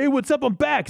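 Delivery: very high surfer-bro voice; Yamcha. Acted. Freshly resurrected Yamcha announces his return, surfer-dude impression. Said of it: "doing a shitty Michelangelo impression".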